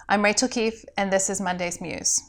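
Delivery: ordinary delivery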